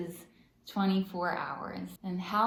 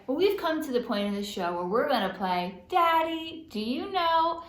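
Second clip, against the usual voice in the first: game show announcer; 6 semitones higher, 3 dB louder than usual. Acted. Announces quiz segment name.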